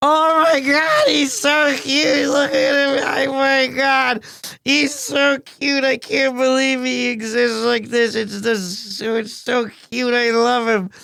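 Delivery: squealing, doting coo. Real, not acted. Gushing over baby photo.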